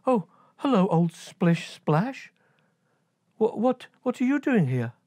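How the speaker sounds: put-on high, bright girlish voice; high excited little princess; high young princess greeting.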